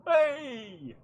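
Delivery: put-on high loud drunk yell; rowdy tavern holler; drunken shout. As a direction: bellow like a drunk outside. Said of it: "drunk people outside going".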